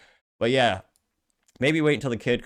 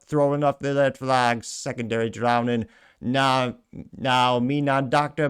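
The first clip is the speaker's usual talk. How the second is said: slow, hammed-up Jamaican accent; admittedly terrible Jamaican accent; bad Jamaican accent; 2 semitones higher, slower than usual. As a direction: read the AI reply in a clumsy Jamaican accent. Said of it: "Respond in a Jamaican accent".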